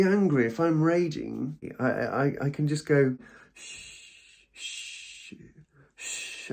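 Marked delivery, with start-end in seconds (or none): 3.5-6.5 soft unvoiced shushes to calm down; shushing. Shown as a demonstration. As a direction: hush yourself gently. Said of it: "I started shushing myself".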